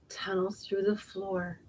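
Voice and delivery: soft unvoiced whisper. A narrator's reading. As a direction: sneak the line out in a hush. Sneaky tunnelling animals.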